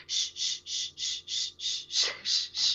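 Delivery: long unvoiced shh, shh, over and over; shushing. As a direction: shush softly like calming a baby. Shushing her crying son.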